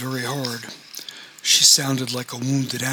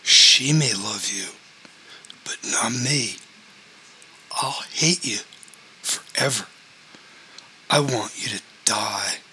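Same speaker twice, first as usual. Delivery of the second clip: snarling; 2 semitones lower than usual. A narrator's reading. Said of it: "Ron snarled".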